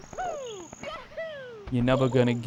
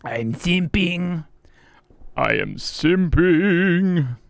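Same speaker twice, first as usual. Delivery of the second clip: slow, stretched "I am simping"; slow, sing-song self-mockery; drawn-out declaration; 5 semitones higher, much slower than usual. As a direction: draw it out, hamming it up.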